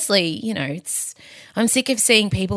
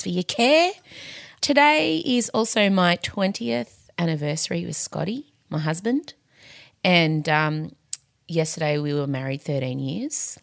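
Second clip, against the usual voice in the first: normal talking voice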